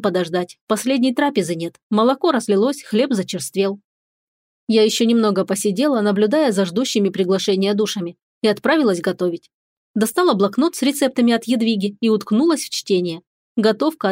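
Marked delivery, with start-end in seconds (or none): none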